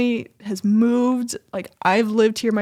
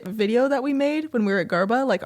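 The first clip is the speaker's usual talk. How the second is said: ordinary delivery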